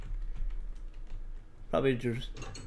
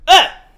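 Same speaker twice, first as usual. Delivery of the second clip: short high loud scream; real frightened shriek; startled scream; 13 dB louder than usual. Real, not acted. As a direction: scream at the sudden scare. Jump scare.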